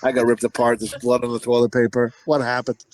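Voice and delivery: low, hushed horrified mutter; shaken whisper-like. Retold or imitated. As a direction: say it low and disturbed. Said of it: "I was like".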